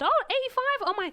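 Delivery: high-pitched mock astonishment; surprised exclamation. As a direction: voice leaps up, imitating an amazed pharmacist. Retold pharmacist's surprised reaction.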